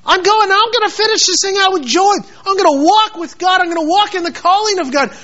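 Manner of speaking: Paul's joyful resolve. Retold or imitated. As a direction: declare it like a man ready to finish the race. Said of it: "Paul says".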